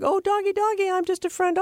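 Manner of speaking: put-on high, sweet baby-talk to a dog; coaxing the doggy. Host mimics a neighbor sweet-talking the barking dog, high baby talk. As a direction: coo at the dog like a pushover neighbor. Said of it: "try to make friends with the dog".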